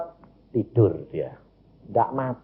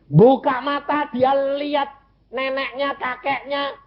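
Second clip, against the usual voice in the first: high, sustained testimony climax; impassioned preaching; 13 semitones higher than usual. Real, not acted. Preacher recounts child opening her eyes, high and impassioned. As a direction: lift the miracle high and hold it there.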